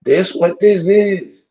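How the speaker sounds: high, slow, drawn-out disbelief; mock-shocked call-out, slowed right down; disbelieving challenge. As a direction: stretch it out like you can't believe what you're seeing. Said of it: "I'm like".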